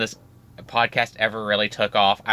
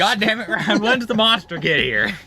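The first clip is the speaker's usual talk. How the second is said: mock outrage; 10 semitones higher, 5 dB louder than usual. Mock outrage at the slow plot.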